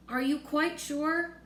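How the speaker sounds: nervous general, slow and high; anxious. A narrator's reading. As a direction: ask it with rising nerves. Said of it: "the army man said anxiously".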